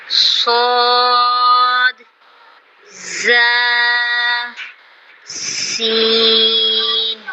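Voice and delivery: صاد, زا and سین held long with a hiss; whistling letters. Shown as a demonstration. Teacher showing the whistling letters, long and hissed. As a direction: draw out each whistling letter. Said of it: "سیٹی والے حروف".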